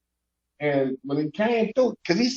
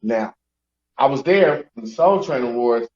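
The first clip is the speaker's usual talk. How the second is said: usual speaking voice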